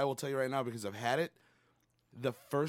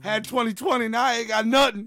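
mock victim lament; 9 semitones higher, 11 dB louder than usual.

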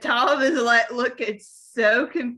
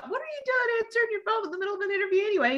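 high, rapid mock telling-off; imitated fussy reprimand; mock incredulous scolding; 9 semitones higher, 4 dB quieter, much faster than usual. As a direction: rattle it off in high-pitched disbelief. Acts out one actor quizzing another.

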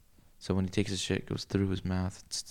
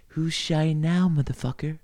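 mock taunt; 5 semitones higher, 5 dB louder than usual. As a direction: drag it out as you get even. Mock revenge line at party.

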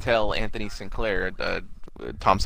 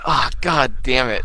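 dismayed groan; 4 dB louder than usual. Retold or imitated.

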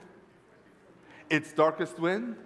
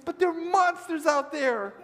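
put-on high, loud child's protest; frightened little boy; 12 semitones higher, 5 dB louder than usual. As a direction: protest like a scared small boy. Boy afraid of monsters in garage.

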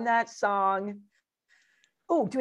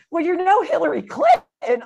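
knowing insinuation; 8 semitones higher, 8 dB louder than usual. Retold or imitated. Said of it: "They're like".

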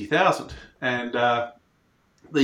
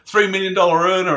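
higher, sing-song mock taunting; mocking tease; 6 semitones higher, 4 dB louder than usual. Mates taunt [name] about the horse he missed, mock teasing. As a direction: rub it in like a merciless mate. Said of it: "are just merciless to me".

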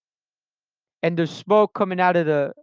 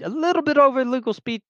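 coy admission; 7 semitones higher than usual. Real, not acted. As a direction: own up to it in a high voice.

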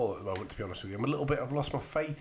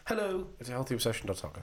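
happier. Real, not acted. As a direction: perk it up for the listeners. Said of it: "That's better".

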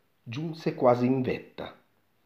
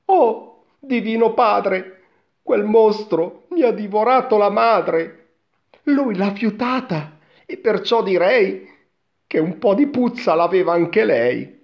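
put-on high, loud boy's lament; wailing boy; 10 semitones higher, 8 dB louder than usual. A narrator's reading. Son learns his mother was devoured, high loud lament. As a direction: exclaim in high-pitched dismay.

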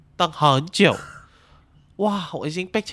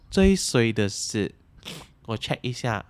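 normal talking voice

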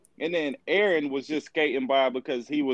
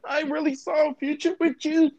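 very high, hammed-up heartbreak; fake-cry it in a squeaky high voice; mock tearful; 14 semitones higher than usual. Mocks contestant's fake crying after breakup.

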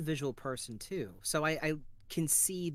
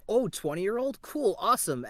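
higher, louder, eager reaction; mock excitement; 6 semitones higher, 7 dB louder than usual. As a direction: perk up with acted enthusiasm. Acts out reading a promising call.

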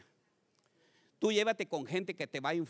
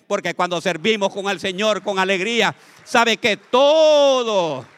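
much louder, stretched out words; fiery preaching; 14 dB louder than usual. Acted. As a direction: preach it out with full force. Preaching at full force.

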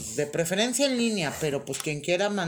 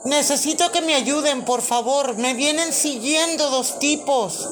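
loud, high, frantic plea; panicked caller; 7 semitones higher, 6 dB louder than usual. Acted. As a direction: beg for help in a panic.

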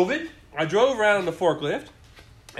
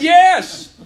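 loud yes; 11 semitones higher, 14 dB louder than usual. Retold or imitated. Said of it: "I said".

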